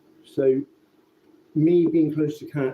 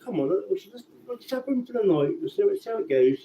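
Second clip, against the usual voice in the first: pleading with dad; 7 semitones higher than usual. Retold or imitated. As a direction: voice jumps much higher, coaxing. Begging dad to keep cat.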